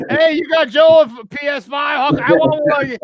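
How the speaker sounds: high, slow, shouted pleading caller; imitating a caller shouting for a PS five; mock begging caller. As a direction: ham up a pushy friend begging for a favor.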